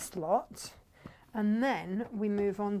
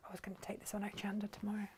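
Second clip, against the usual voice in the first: fast, breathy aside whisper; 13 dB quieter, much faster than usual. Real, not acted. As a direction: whisper it quickly to yourself.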